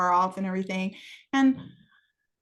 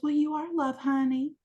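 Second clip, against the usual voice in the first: higher-pitched, motherly Texan voice; mom's Texan accent; 6 semitones higher than usual. Retold or imitated. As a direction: lightly imitate a Texan mom. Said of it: "real thick Texan accent".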